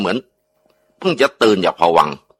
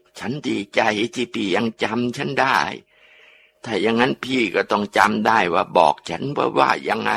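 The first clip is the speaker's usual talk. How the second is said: ghost voice; 2 semitones higher, 6 dB quieter than usual. Acted. Dead wife tells husband she's glad he remembers, soft ghost voice. Ghost speaks through possessed aunt.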